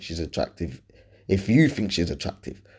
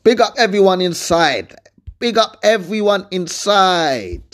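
loud shout-out; 9 semitones higher, 5 dB louder, slower than usual. Real, not acted. Podcast shout-out to listeners.

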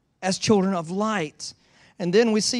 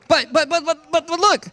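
ordinary talk, nothing marked

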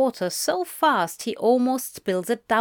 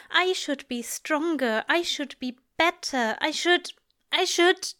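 high-pitched self-reproach, breaking off unfinished; frustrated; 6 semitones higher than usual. A narrator's reading. Aziraphale berating himself to Crowley, increasingly frustrated. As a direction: pile up the self-blame, voice higher, faltering at the end. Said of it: "frustration lacing his words".

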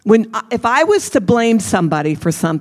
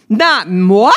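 a sly, louder mock-French "moi"; 6 dB louder than usual. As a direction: say "moi" like a haughty Frenchman, tongue in cheek. Mock-French denial of own blame.

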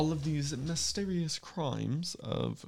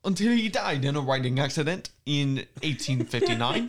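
loud mock posh English, half laughing; loud, quick posh accent; posh but louder; 2 semitones higher, 8 dB louder, faster than usual. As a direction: declaim it in loud hammed-up posh. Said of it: "just do posh but louder".

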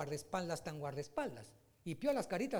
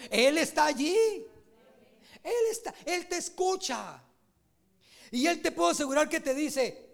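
full-force preaching; 6 semitones higher, 7 dB louder than usual. Acted. Pastor proclaiming God's presence to the congregation, full-force preaching. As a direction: preach it out, swelling with each line.

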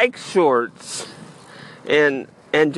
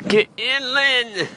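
calling out; 9 semitones higher, slower than usual. Real, not acted. Urging himself out of the fog.

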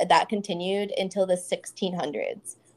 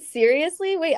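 high, loud disbelief; really can't believe it; surprised; 10 semitones higher, 5 dB louder than usual. Real, not acted. Surprised by a fact.

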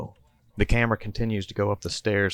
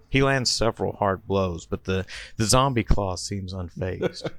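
ordinary talk

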